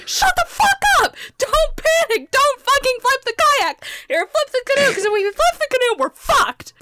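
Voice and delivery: high, loud barked orders; imitated frantic yelling. Ben tells screaming friends not to flip canoe, frantic shouting. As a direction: yell commands over everyone's screaming, re-enacted.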